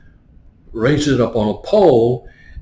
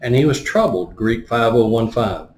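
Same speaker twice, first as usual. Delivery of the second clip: ordinary delivery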